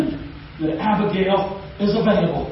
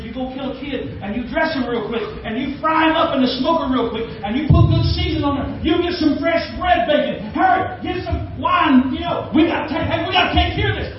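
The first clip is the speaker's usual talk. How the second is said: urgent orders; 7 semitones higher than usual. Retold or imitated. Abigail hurries the servant.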